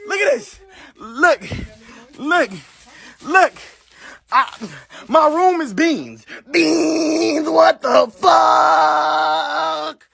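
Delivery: slow, drawn-out wail that breaks into a shout; despairing cry. Real, not acted. Showing his bean-covered room to viewers, wailing in despair. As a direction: let the disbelief crack into a sob.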